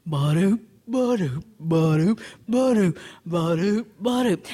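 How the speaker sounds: rhythmic lumbering footstep noises; sauntering bear. Acted. Bear walks on, voiced footsteps.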